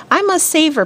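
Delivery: high, urgent cry; cried out. A narrator's reading. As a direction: call it out in a rush of resolve. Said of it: "Peter cried".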